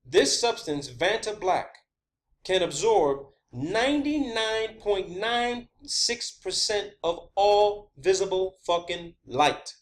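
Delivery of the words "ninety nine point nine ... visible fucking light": slow, deliberate stress on each word; emphatic, word by word. Real, not acted. Presenter stressing the absorption figure, slow and emphatic. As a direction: spell out NINETY-NINE POINT NINE SIX slowly for impact.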